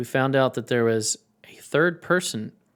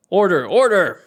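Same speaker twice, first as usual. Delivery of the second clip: raised, higher judge's call; commanding courtroom shout; calling for order; 4 semitones higher, 6 dB louder than usual. Acted.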